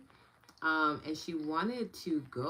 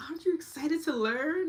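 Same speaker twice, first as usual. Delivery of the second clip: excited question; 13 semitones higher, 8 dB louder than usual. Retold or imitated. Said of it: "And I was like".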